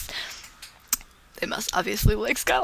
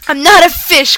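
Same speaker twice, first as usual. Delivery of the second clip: yelled back; 8 semitones higher, 11 dB louder than usual. Acted. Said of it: "Quentin yelled back".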